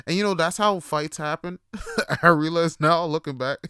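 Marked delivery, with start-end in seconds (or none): 1.6-2.2 short high chuckle; laugh. Real, not acted. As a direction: burst of amused laughter. Laughs at his own story.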